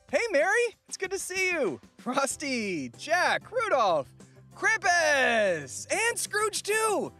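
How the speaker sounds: high-pitched, gleeful roll call; delighted greetings. Acted. Rescued man greets everyone who helped, delighted. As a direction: greet each friend with rising delight.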